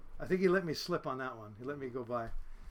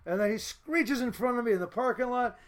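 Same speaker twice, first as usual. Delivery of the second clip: usual speaking voice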